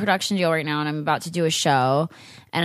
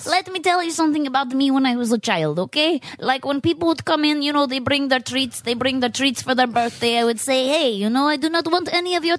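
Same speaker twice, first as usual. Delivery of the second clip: put-on Russian accent; 6 semitones higher than usual. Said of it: "Oh, no, you talk Russian".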